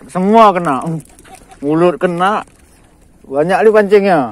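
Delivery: loud, thrilled calls over the catch; loud eager cheering; excited shout. Real, not acted. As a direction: shout it out as every hook gets a bite. Fish biting everywhere.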